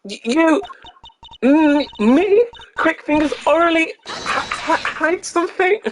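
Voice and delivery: high panicky witness voice; flustered witness. Acted. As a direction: play her caught out and panicking. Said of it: "voicing flustered witness Orley".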